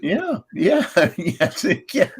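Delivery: high, bubbling laugh through words; giggling while agreeing. Real, not acted. Laughing along at a joke.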